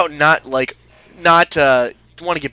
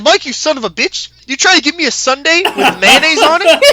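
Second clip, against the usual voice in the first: higher and louder hammed-up outrage, laughing through it; playful fake fury, much higher; mock angry accusation; 10 semitones higher, 7 dB louder than usual. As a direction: pretend to be furious with the manager, half laughing. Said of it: "I said".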